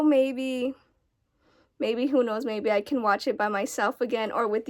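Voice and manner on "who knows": ordinary talk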